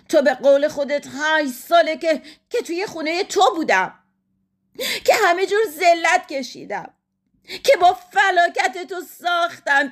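high-pitched, impassioned tirade; hurt, furious wife. A narrator's reading. Wife refusing to stay with husband, impassioned. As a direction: pour out years of grievance.

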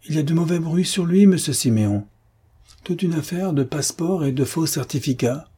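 high-pitched, worried caution; warning concierge. Acted. Concierge warns of doctor's reputation.

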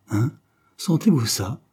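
plain reading voice

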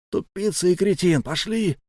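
high, strained rebuke; exasperated scolding. A narrator's reading. Sablya berating his comrade and moving on, exasperated. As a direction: scold him and hurry him along.

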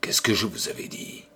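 hushed, quick half-voice; half-voiced. A narrator's reading. Makoko warning his friends under his breath, hushed. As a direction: keep it under your breath, nervous. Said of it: "Makoko fit à mi-voix".

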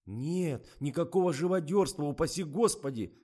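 high, loud protest; hasty denial. A narrator's reading. Zhorik waves away talk of cruelty, emphatic denial. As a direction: deny it emphatically, heading off the accusation. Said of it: "замахал руками Жорик".

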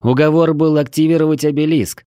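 loud, high protest; disappointed exclamation. A narrator's reading. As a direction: object loudly, feeling let down. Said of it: "разочарованно воскликнул я".

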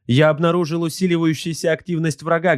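high-pitched subordinate's report; high-voiced crew slave reporting; servile gunnery slave. A narrator's reading. Watch slave reports enemy activity.

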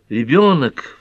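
high, loud exclamation; sudden cry. A narrator's reading. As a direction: cry out the word urgently. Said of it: "вскрикнула Пэт".

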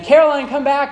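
high, loud chasing call. Retold or imitated. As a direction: shout after your fleeing toddler. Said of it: "and I'm saying".

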